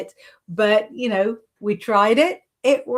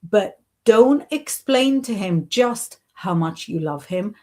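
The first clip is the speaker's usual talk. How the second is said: normal talking voice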